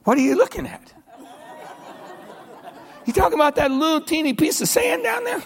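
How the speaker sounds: high, laughing, fast scoffing reply; God chuckling at the mountain; God laughing at the doubters, high; lightly voiced amused God. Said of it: "God has said".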